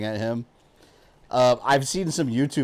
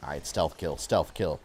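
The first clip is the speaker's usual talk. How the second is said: usual speaking voice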